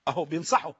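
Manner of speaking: usual speaking voice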